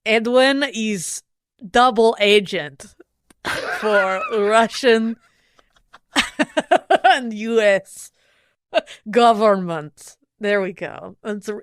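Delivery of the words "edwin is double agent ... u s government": slow, laughing mock Russian accent; hammed-up Russian spy voice. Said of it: "that sounds pretty Russian".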